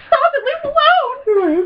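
very high, drawn-out mock outcry; freaked-out college girl. College girl to taunting siblings, freaked-out mock outcry. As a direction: cry out in hammed-up distress. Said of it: "completely freaks out one of the girl".

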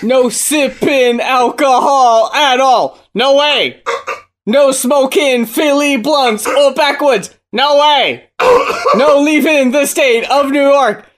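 high, slow, sing-song recital; chorus read-out. Retold or imitated. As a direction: recite each line with plodding, exaggerated rhythm. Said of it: "Can I just read this chorus".

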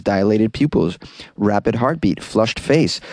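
ordinary delivery

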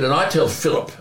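usual speaking voice

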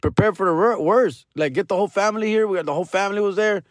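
high doctor voice; doctor's warning. Retold or imitated. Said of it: "he's like".

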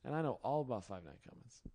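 ordinary talk

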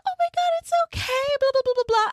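very high squeaky cooing; hammed-up sympathetic girlfriends in falsetto; mock consoling. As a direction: coo it sky-high, mocking. Mocking women consoling the crier.

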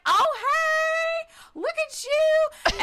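delighted, high, loud squeal of greeting; Angie. Retold or imitated. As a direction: imitate a gushing, drawn-out congratulation. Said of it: "And she was like".

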